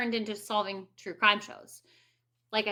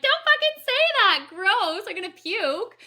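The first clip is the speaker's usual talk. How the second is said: much higher, louder mock disgust; grossed-out reaction; 8 semitones higher, 5 dB louder than usual. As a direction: squeal it like a compliment makes you sick. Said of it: "you're like".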